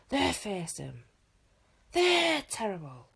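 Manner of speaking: forced announcer shout, rising; half-hearted yell. A narrator's reading. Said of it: "put a little bit of enthusiasm into his yelling".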